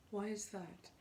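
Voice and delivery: ordinary talk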